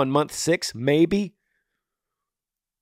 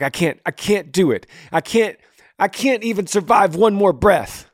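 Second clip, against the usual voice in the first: higher-pitched, mounting desperation, retold; desperate plea; 7 semitones higher than usual. Grieving father reliving his worst moments, desperate. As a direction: plead it out, reliving the overwhelm. Voices his despairing self in grief.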